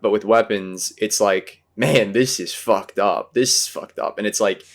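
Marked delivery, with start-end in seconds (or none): 1.8-4.2 wacky disbelief. Retold or imitated. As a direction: stretch it out, reveling in the craziness. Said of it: "so much wacky stuff going on".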